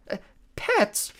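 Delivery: very high squeaky stammering; nervous falsetto. A narrator's reading. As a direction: stammer in a fake bride voice. Grover as bride.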